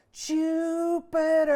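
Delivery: long, high, very loud operatic call of the name; mother calling her son across the street, belted at full lung; belting call. Acted. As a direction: belt it out like an opera singer. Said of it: "she belts out".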